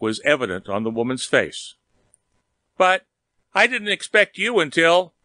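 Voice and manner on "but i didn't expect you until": startled protest. Acted. Caught by her lover.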